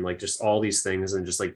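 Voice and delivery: ordinary talk, nothing marked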